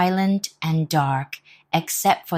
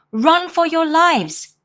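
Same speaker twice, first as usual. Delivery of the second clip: much higher, louder slow panicked shout; 12 semitones higher, 6 dB louder, slower than usual. Acted. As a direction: flee shouting the alarm. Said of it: "cried the robbers".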